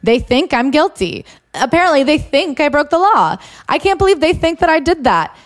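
high, whiny mock disbelief in Teresa's voice. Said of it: "the rest of the book is her saying".

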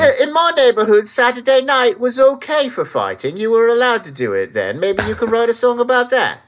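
gruff Cockney, like a London geezer; Cockney accent. Retold or imitated. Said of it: "I don't know why he's Cockney now".

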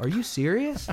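high, rising retold disbelief; incredulous. Retold reaction to friend living nearby.

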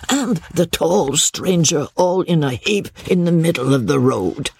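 humble peddler voice, mostly pitched high; boastful old peddler. Acted. Old peddler assuring Chauvelin he will catch Richard, confident and servile. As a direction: talk up your cart as the old peddler.

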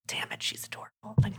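acted private thought, barely voiced; whispered aside. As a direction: whisper it like a secret admission. Retold smitten inner thought.

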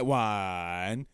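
ordinary delivery

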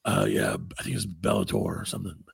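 low mock action-star storytelling; Steven Seagal. Host re-enacts Seagal interview about a fighter, low sinister voice. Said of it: "And he's like".

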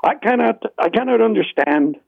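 ordinary talk

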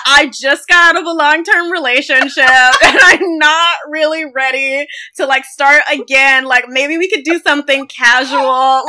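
hammed-up casual brush-off, loud and laughing; mock fuckboy excuse. Mocking Louis's excuse.

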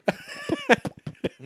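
squeaky falsetto laugh burst; high squeal laugh. Acted. Mimics fish noise laughing.

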